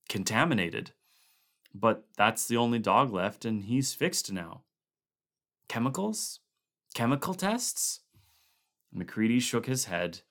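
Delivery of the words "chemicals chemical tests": hopeful question. Acted. Gary grasping at alternatives.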